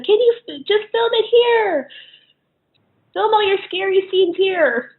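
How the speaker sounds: high, eager coaxing voice; imitating grandmother and aunt. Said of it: "They're both like".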